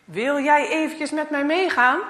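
a loud stern grown-up voice; stern man's voice. Acted. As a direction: stop the boy with authority. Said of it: "een stem boven zijn hoofd". (strenge mannenstem)

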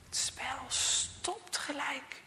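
ordinary delivery, nothing marked

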